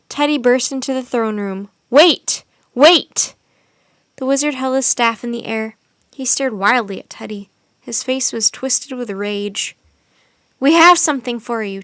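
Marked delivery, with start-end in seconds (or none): 1.9-3.3 yelled wait. Acted. Teddy bursting in, stopping spell. 10.6-11.8 much louder call across the hall; shout. A narrator's reading. Teddy shouts at the wizard over the commotion. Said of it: "Teddy shouted at the wizard".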